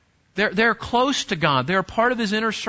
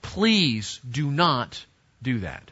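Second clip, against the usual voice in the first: low, very slow, measured stern plea; stern parental warning; 8 semitones lower, 3 dB quieter, much slower than usual. Acted. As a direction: spell it out gravely, like a parent laying down the law. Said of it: "with a stern look and a raised voice".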